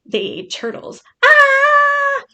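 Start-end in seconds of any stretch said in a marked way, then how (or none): 1.2-2.2 startled yelp. Real, not acted. Startled by her own mistake.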